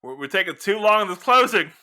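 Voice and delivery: loud, high complaint; mock exasperation. Host rushing the closing, loud mock exasperation. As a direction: raise it in mock impatience. Mock outburst to end show.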